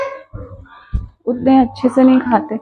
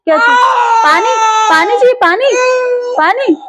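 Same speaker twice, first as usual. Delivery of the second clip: mother asking her crying son what he needs, higher and coaxing; 7 semitones higher than usual. Real, not acted.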